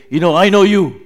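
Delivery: loud mock accusation, not meant; hostile accusation. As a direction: shout it like a threat, hammed up. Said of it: "critical or hostile way".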